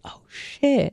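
breathy quiet dismay; soft dismayed oh shit. Retold or imitated. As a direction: drop it under your breath. Retold dismay, muttered.

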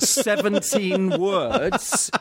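Welsh accent sliding into an Indian accent, laughing; a Welsh reading that drifts into Indian, high and laughing; Welsh accent attempt. Shown as a demonstration. A Welsh accent, failing, reading from a book. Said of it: "my impression badly".

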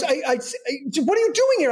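very high stammer; hammed-up flustered star. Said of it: "completely, like, flustered".

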